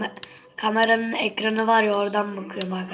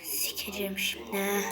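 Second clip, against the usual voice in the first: hushed, breathy swear under the breath; whispered curse; 8 dB quieter than usual. Real, not acted. Muttered curse at the game.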